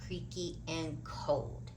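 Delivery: plain reading voice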